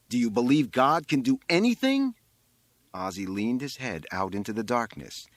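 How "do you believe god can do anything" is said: high, loud questioning; calling out a demand. A narrator's reading. Boy on the roof testing the rabbi, loud demand. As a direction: press the question, voice raised.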